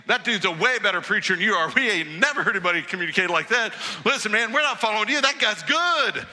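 hammed-up giddy guards with a laugh; excited guards. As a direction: rave like star-struck fans.

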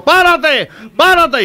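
high, loud shout to halt; acted shouting. Knifeman chasing a man who runs ahead, shouting. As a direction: yell it like a man giving chase. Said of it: "le está gritando".

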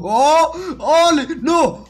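high-pitched frantic yells; real gamer alarm, pitched high; panicked shouting. Real, not acted. Crocodile attacks through the wall.

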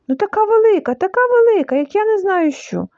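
put-on high, breathless-child style exclaiming; frightened excitement. A narrator's reading. Piglet excitedly describing the monster. (схвильовано й налякано)

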